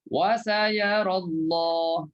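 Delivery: very slow drawn-out chant; tajwid recitation. Shown as a demonstration. Ustaz demonstrating to his class, slow chant. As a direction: stretch "sayarallah" out slowly. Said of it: "kalau sambung tak boleh lah".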